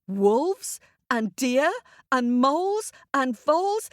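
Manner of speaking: ordinary delivery, nothing marked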